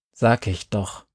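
low, grumbling agreement; grumbled. Acted. Boy grumbles to his friends, lightly grumbling. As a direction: grumble it like a sulky kid. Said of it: "brummte Jerry".

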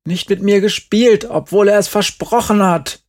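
high, loud complaint; aggrieved boy protesting loudly; indignant call. A narrator's reading. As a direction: call it out, sorely wronged. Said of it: "rief Markus".

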